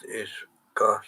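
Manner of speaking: ordinary delivery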